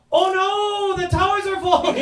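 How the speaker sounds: high, loud mock panicked New Yorker. Comedian mocks New Yorkers back, very high mock panic. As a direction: shriek it in mock alarm. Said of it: "I'm not like".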